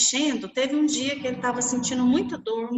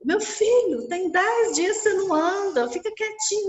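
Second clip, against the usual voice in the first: much higher and louder, pleading mother; anxious mother; 9 semitones higher, 4 dB louder than usual. Retold or imitated. Mother begs her son in the ICU to lie still, high and anxious. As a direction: hold him back, worried and loud.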